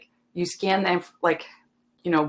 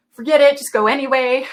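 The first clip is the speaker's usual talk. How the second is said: higher, louder, fed-up override; mock exasperated shout; 8 semitones higher, 9 dB louder than usual. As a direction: wave it off in frustration. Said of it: "and I, like".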